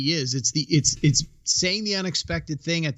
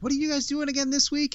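mimicking wife; 11 semitones higher than usual. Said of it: "She's like".

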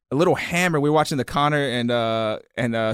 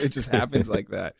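ordinary delivery

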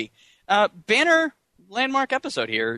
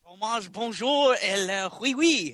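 slow, drawn-out fake French; mock French accent; 2 semitones higher, slower than usual. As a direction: toss out French words in a cartoon French accent. Joins in the mock French bit.

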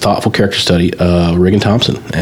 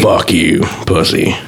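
gravelly voice; 2 semitones lower, slower than usual. Acted. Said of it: "That was good".

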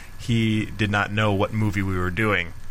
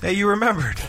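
mock excited; 10 semitones higher, faster than usual. Mock excited host cue.

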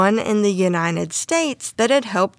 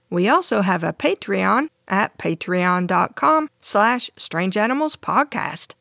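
usual speaking voice

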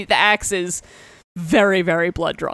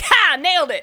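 high, gleeful retold boast; mock triumph; 8 semitones higher than usual. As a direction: crow over a lucky hit, hammed up. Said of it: "just like".

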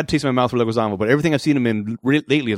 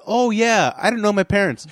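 mock casual; 7 semitones higher than usual. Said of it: "just as casual".

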